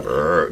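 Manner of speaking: low guttural growl on purpose; guttural sound. Shown as a demonstration. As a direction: drop down low into a throaty "brr". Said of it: "a prescribed guttural sound".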